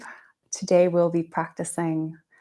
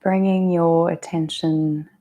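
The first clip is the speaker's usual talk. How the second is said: unhurried yoga nidra guidance, drawn-out phrases; guided meditation. Real, not acted. Yoga teacher guiding listeners through breath and intention, guided meditation.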